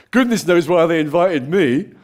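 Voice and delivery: high, loud self-deprecating Brit; mock-humble British aside. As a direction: fake British modesty. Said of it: "the British thing".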